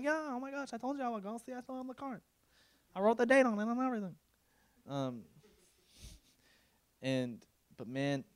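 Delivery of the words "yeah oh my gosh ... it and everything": high, light put-on voice; mock eager gushing. Invitee telling host they kept the card, mock eager gushing. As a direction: gush on in a high, eager run.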